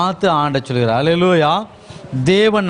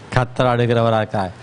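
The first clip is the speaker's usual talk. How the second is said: ordinary talk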